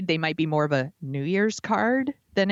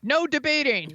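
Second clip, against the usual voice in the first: mock strict moderator; 9 semitones higher than usual. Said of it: "show up and be like".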